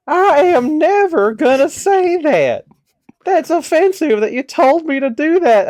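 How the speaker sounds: high, slow imitation of an indignant old man; hammed-up old-timer's protest; offended old man. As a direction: pitch it way up, old and affronted. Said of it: "He's just an old man".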